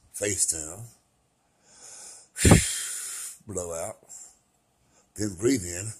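walk them gently through the breaths; guided meditation. Real, not acted. Guiding a breathing exercise for viewers, low and soft.

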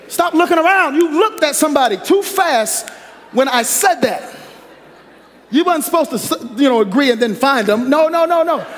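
high, loud playful scolding with a laugh; laughing tease. Real, not acted. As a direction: catch them looking and laugh. Teasing the congregation.

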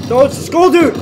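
loud high frightened cry; alarmed shout. Real, not acted. Player sees the skull enemy and yells. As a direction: yell the warning.

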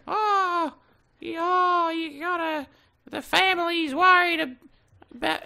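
very high mock mom voice; mom imitation. Gamer mocks his worried mom, in a very high voice. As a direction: squeak it like a fretting mom. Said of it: "My mom has been like".

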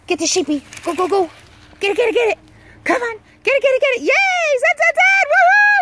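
shrill high squealing cheers to a dog; hyping the dog up to grab the toy, ecstatic squeals; excited dog cheering. Real, not acted. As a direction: whip the dog into a frenzy, very high and loud.

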